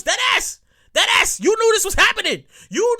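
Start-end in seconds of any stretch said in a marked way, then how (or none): 0.0-1.4 high New York slang; New York accent. Pretend. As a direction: say it like a New Yorker. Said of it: "In my New York voice".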